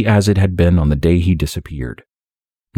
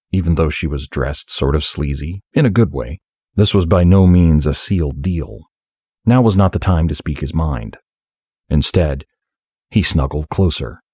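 plain reading voice

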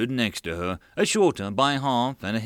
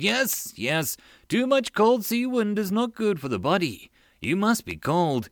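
eager; 9 semitones higher than usual. Acted. Eager fawning agreement.

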